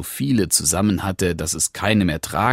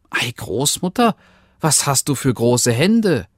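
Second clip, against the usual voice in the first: put-on little girl; 6 semitones higher than usual. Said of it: "rief es".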